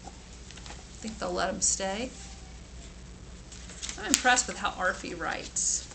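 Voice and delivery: ordinary delivery, nothing marked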